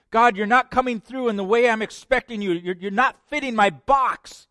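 high, loud, aggrieved protest; frustrated complaint. Acted. Believer complaining to God, high and frustrated.

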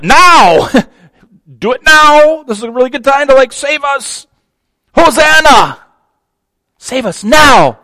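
high, loud, insistent crowd demand; excited impatient crowd shouting now; urgent demand. Retold or imitated. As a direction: urge him to act right now. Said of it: "at the end kinda means".

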